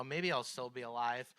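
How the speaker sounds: usual speaking voice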